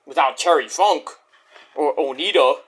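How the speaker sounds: normal talking voice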